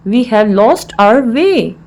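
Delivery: slow, word-by-word teaching delivery; slow repeat. Shown as a demonstration. Teacher repeats the English phrase for learners, slowly.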